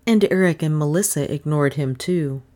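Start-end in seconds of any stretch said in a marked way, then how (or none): none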